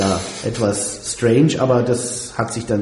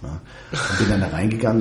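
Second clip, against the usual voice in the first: ordinary delivery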